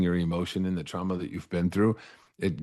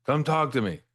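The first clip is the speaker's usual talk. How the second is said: higher voice calling down the hallway to a neighbour; 7 semitones higher than usual. Retold or imitated. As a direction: call out across the cells, friendly and coaxing. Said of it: "I hear him call over".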